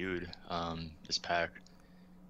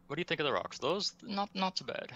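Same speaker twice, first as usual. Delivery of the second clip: put-on British accent; 11 semitones higher than usual. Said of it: "I'm gonna try to be British right here".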